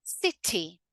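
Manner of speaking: "city" with a clearly sounded T; careful "si-tee"; full emphasized T. Shown as a demonstration. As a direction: really pronounce the T in "city". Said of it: "I really pronounce it as a T".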